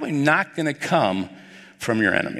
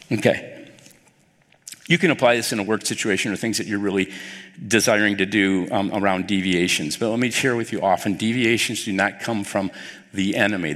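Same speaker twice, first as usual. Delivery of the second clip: usual speaking voice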